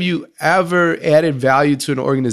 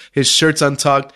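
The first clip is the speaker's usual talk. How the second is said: ordinary talk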